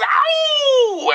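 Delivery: very high howled ow like a dog. Acted. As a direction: howl it out like a hound baying at the moon. DJ howls after his name.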